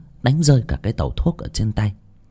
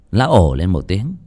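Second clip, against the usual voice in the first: plain reading voice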